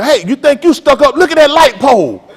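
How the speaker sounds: loud, high-pitched holler; hollered comeback. Acted. Man calls after a girl who won't look, hollering. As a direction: shout it after someone walking away. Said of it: "just holler".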